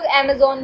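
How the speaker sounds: अमेज़न ending in a round "zone"; pronunciation variant. Shown as a demonstration. Teacher offering a third variant, अमेज़न ending in "zone". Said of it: "अमेज़न भी कहते हैं".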